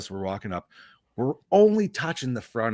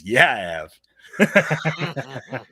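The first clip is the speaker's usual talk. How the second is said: laughing admission; 5 dB louder, much slower than usual. Retold or imitated. His reply to wife, quoted.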